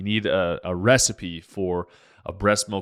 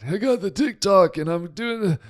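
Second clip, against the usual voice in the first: put-on higher, rambling old-man voice; George Bush as an old man; 8 semitones higher than usual. Doctor mocking himself on TikTok replies as an old man. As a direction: put on a doddering hundred-year-old man. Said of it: "I sound like a hundred-year-old man".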